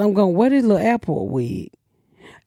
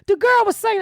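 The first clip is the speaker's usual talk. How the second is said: ordinary talk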